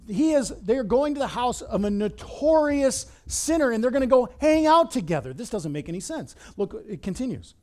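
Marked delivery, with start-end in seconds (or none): 0.1-5.3 louder, higher scandalized complaint; indignant crowd. Acted. Displeased crowd objecting to the visit with a sinner, indignant. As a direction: protest it loudly in disbelief.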